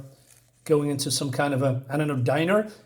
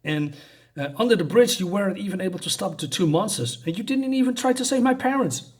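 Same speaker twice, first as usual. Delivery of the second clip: put-on boy lashing out at his guardian, higher and higher; angry outburst; 5 semitones higher than usual. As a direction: pitch climbing higher, angry and near tears. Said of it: "he's getting a little bit angry".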